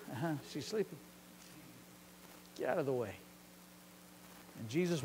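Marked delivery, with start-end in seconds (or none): 0.1-3.1 low, much softer, unfazed; calm unbothered reply. Acted. Jesus calmly dismissing the mocking crowd, low and soft. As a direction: brush them aside quietly.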